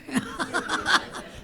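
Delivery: high, loud burst of laughter; amused laugh after a punchline; happy laugh. Real, not acted. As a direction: laugh at your own joke. Laughs after her joke.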